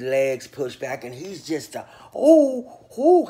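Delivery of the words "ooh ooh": high, loud ooh ooh hollers; mock hollering. Describing the cell scene, loud high mock hollering. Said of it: "he's just a hollering".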